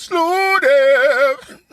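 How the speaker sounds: high, loud mock yell of the word slew; loud mock shout. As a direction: bellow it back as the reggae artist would. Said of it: "He goes".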